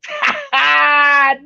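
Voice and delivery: high-pitched burst of laughter. Real, not acted. Host cracking up at the imitation, high laugh.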